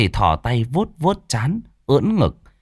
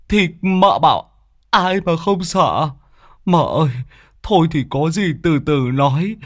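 higher, anxious coaxing plea; pleading old man; 8 semitones higher than usual. Acted. Sweaty old man begging the wife to put down the cleaver. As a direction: talk her into dropping the knife.

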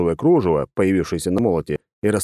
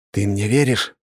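usual speaking voice

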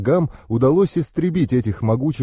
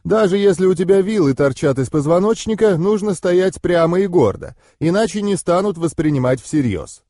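stern; 6 semitones higher than usual. A narrator's reading. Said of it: "строго произнесла она".

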